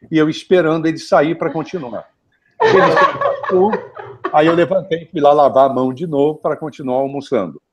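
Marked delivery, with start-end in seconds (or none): 2.6-3.7 laughing. Real, not acted. Laughing as fan leaves.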